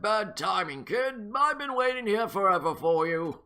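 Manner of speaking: lightly voiced grumpy old man; a hint of an impatient old man. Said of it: "which old man voice to use for him".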